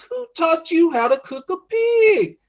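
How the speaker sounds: high, drawn-out mock outrage; indignant husband. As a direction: challenge the wife, slow and incredulous. Husband challenges wife in story.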